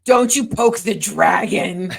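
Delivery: slow, loud mock threat; teasing growl of a warning; mock dragon warning. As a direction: ham up a dragon warning through a laugh. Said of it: "you were like".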